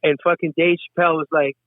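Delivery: ordinary talk, nothing marked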